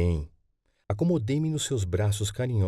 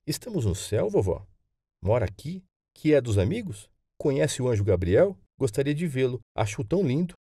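put-on higher, eager child's questions; curious young grandson; 4 semitones higher than usual. Boy asks grandmother about heaven and angels, eager curiosity. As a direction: play a wondering boy, lifting the pitch.

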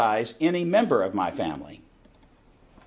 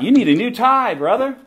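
mock imitation; 11 semitones higher, 6 dB louder than usual. Mocking the man's advice.

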